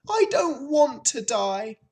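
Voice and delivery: high, tearful piglet wail; moaning. A narrator's reading. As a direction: moan it as the despairing pig. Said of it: "he moaned".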